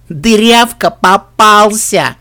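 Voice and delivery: loud, drawn-out teasing crow; gloat, stretching out the last word; taunting through laughter. A narrator's reading. Sister teases her snow-covered brother, gleefully mocking. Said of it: "сквозь смех выдавила из себя Диди".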